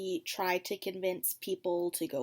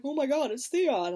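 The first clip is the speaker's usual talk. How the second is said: mock shock; 5 semitones higher, 6 dB louder than usual. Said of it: "Sansa's like".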